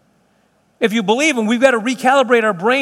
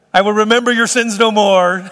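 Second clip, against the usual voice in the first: loud, laughing pronouncement; playful mock-solemn proclamation; laughing mock declaration; 5 dB louder than usual. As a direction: declare it loudly through laughter. Joking through laughter about the car.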